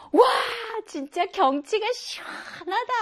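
high voice wowed by open scenery; awed at the view. Acted. Teacher acting a viewer admiring open scenery, awed.

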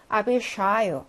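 "I will show you" as Russian, stressed long "sha" in "show"; model reading. Shown as a demonstration. Teacher rereads for students, "show" as stressed "sha". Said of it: "다시 한번 읽어볼게요".

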